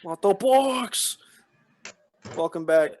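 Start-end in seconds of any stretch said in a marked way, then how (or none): 0.0-1.2 slow character voice. Pretend. Character offering.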